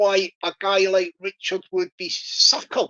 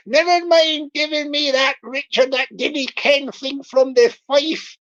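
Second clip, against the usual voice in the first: broad, hammed-up Bristol farmer accent; Bristol scammer voice. Scammer coaxing money out of Richard, mock Bristol accent. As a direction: cajole in a thick mock Bristol burr. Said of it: "I'll do the Bristol voice".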